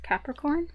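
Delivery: CAPRICORN lifted into a rising question; questioning intonation. Real, not acted. Tarot reader announcing the next sign, CAPRICORN rising like a question. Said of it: "said that like a question".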